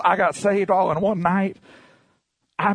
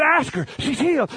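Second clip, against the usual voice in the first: insistent repeat; 9 semitones higher than usual. Retold or imitated. Insisting again.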